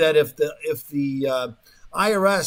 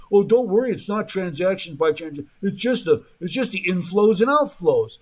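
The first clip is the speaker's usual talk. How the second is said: louder, higher-pitched brush-off; mock reassurance; 5 semitones higher, 5 dB louder than usual. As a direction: imitate an official waving away worries. Mimics officials brushing off privacy worries.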